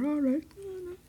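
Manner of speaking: put-on high, meek little-girl voice; timid child saying yes to her aunt; small child's reply. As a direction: answer like a cowed young girl. Child answers scolding aunt.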